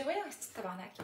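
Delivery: Québécois exclamation; everyday Québécois accent. Shown as a demonstration. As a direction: swear in a natural Québécois accent. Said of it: "c'est réellement mon accent".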